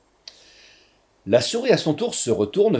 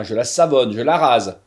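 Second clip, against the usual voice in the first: plain reading voice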